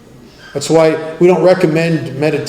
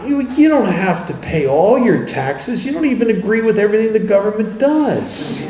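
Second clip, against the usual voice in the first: inner voice; 6 semitones higher than usual. Retold or imitated. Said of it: "It says things like".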